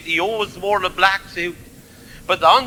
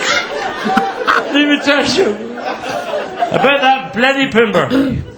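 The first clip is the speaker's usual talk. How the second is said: laughing through the words, slowly; 5 semitones higher, slower than usual. Real, not acted. Joker laughing into his next story, laughing. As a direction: still cracking up as he starts.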